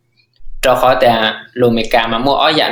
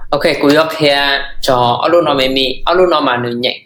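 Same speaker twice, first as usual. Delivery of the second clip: ordinary delivery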